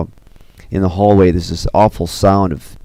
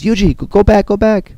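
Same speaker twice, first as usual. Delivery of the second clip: high-pitched, alarmed call; acted cry of warning; urgent warning cry; 14 semitones higher, 4 dB louder than usual. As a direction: urge someone back in a high, strained voice. King urgently warns Yuji away.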